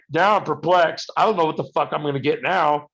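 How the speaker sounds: ordinary talk, nothing marked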